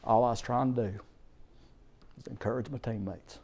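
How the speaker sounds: quietly, near tears; softly voiced tearful son; son's tearful answer. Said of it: "He said".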